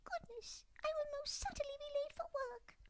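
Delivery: very high, fast dormouse; a fussy little mouse; hurried mouse. Acted. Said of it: "the mouse declared".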